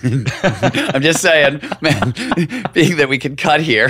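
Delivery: high, giggling teasing aside; laughing while ribbing the host; laughing tease. Real, not acted. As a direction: tease him through laughter. Teasing host about the mispronunciation.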